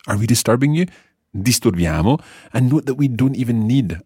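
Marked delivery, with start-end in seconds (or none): 0.1-2.2 "Are we disturbing you" and "Disturbiamo" each rising at the end; question intonation. Shown as a demonstration. Teacher shows learner how intonation makes a question, rising questioning tune. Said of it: "changing the intonation of your voice".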